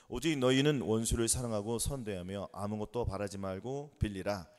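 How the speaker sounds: slow, low monotone recitation; scripture reading. Acted. Preacher leads congregation in reading Luke aloud, solemn recitation. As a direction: read it out slowly and evenly for all to follow.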